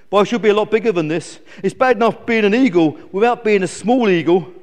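lightly voiced sulky eagle; whingeing eagle. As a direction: moan about being small.

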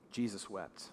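quiet, grave reading of Jesus wept; hushed solemn reading. A narrator's reading. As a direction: let the verse fall almost silent. Solemn reading of the key verse.

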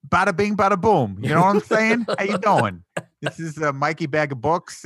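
loud, high, hammed-up New York Italian accent; over-the-top New York accent. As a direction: ham up a Brooklyn wiseguy. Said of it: "her accent in this was so ludicrously over the top".

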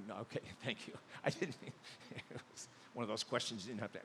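ordinary delivery

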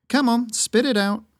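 voiced huntress pressing her friend; Yuff prodding. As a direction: push him to spit it out. Yuff demands he speak.

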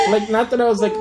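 usual speaking voice

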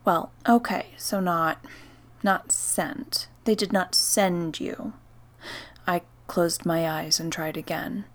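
usual speaking voice

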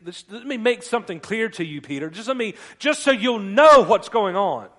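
acted rebuke, bursting out at the end; stern setting-straight. Acts Jesus setting Peter straight.

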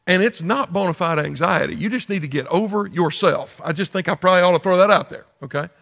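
ordinary talk

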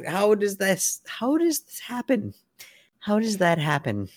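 high, incredulous voice; can't believe what you see; disbelief. Real, not acted. Shocked at the turned page.

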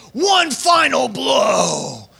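slow, loud drawn-out battle cry; aggressive. Retold or imitated. Preacher voicing an aggressive God, slow loud shout. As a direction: bellow "One final blow" like a warrior god. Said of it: "all of those are really aggressive".

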